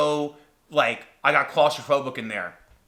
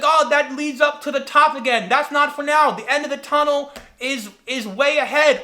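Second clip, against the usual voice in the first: high, raised guide's voice; calling back; 10 semitones higher, 4 dB louder than usual. Retold or imitated. Guide explaining the route from ahead, calling back. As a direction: shout directions back along a tunnel.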